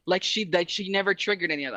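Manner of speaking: ordinary talk, nothing marked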